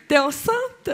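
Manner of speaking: mock confiding girl's voice; knowing smile. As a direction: ask it with a complicit little smile. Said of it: "avec un petit sourire complice".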